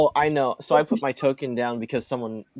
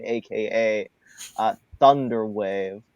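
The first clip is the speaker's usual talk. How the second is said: normal talking voice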